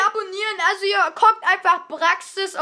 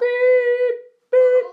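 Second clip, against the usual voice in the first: quack censor; 6 semitones higher, 8 dB louder than usual. Pretend. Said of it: "Ich will das Meme machen".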